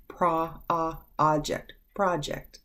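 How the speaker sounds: teacher isolating the "ah" in "project"; ah sound. Shown as a demonstration. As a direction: sound out "project" in pieces with an open "ah": prah, ah, PRAH-ject. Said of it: "to give the ah sound".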